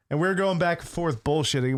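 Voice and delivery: usual speaking voice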